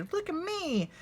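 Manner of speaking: high, small cub voice showing off; a little cub crowing in a big hat; little bear voice. Acted. Little Bear in Grandfather's hat to grandparents, high cub voice.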